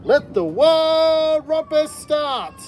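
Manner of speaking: high, loud, slow proclamation; very high, loud and stretched-out cheer; cried out. Acted. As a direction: shout the start of the party. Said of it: "cried Max".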